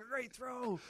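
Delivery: high mock cheer; mock cheering crowd. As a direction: cheer the blunder sarcastically.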